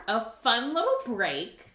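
ordinary delivery

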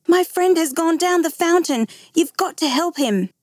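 high-pitched, pleading for help; boy's alarmed appeal, narrated; urgent plea. A narrator's reading. Boy begs constable for help.

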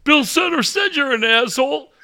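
a hint of a creaky old fan calling in; old man phoning a coach's show, lightly voiced; old caller.

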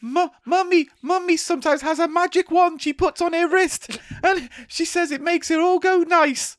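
put-on timid tiny kid; little child voice. Voicing a child on TV.

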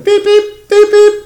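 high squeaky horn noises; beep beep horn imitation. Imitating Vespa horns.

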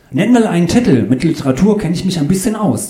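high, loud, keen chatter; eager know-it-all. Acted. Claudius demands a title from the author, high and keen. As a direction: push for an answer in a high eager voice.

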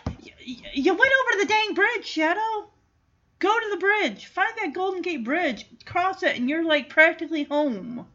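a viewer shouting advice at a dog; urging the screen. Real, not acted. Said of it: "yelling advice at the screen".

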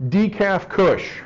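high, very slow deadpan joke; punchline delivery. Pretend. Speaker delivers a pun to the room, slow punchline. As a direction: land the joke slowly. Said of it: "Nope, nobody laughed".

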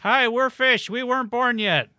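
high silly band intro voice; mock band voice. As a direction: pitch it up like a goofy band member. Mock band members introducing themselves.

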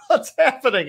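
high, bewildered cry; the pinned mechanic cries out high in confusion; confused panic. Acted. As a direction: sound lost and scared under the weight.

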